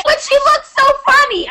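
high, giggly little-sister voice; mimicking sister. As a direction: play the clueless gleeful kid. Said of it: "And Manny's like".

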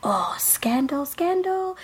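slow, high sing-song scandal, rising up; scandalized gossip. Retold or imitated. Friend retelling gossiping girls, slow high sing-song tease. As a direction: tease it out like giggling schoolgirls. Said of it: "all the girls are like".

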